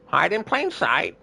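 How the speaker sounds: high slow parrot, plain stressed. Retold or imitated. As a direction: parrot drawing it out. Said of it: "more of an Adam Sandler impression".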